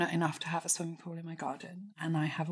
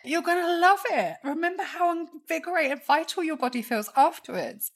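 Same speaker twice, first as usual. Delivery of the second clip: high, bright encouraging coach voice; pep-talk the child in you; cheery self-coach; 11 semitones higher, 4 dB louder than usual. Retold or imitated. Adult self coaxing inner child into cold water, high cheerful. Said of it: "And I'm like".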